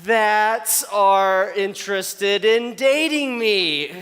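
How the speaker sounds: drawn-out, very slow save; a slow, careful face-saving rephrase; slow careful correction. Retold or imitated. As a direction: stretch each word to cover the slip. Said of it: "That's like".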